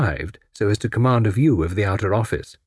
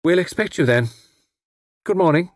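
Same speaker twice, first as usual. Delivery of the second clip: curt, grudging farewell; ungracious; 8 semitones higher than usual. Acted. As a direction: say it without warmth. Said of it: "then said rather ungraciously".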